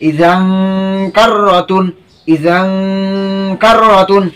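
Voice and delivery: ইজান with a long nasal "ng" hum before কার র তুন; hidden n, ghunnah. Shown as a demonstration. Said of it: "গুণনা করে পড়তে হবে".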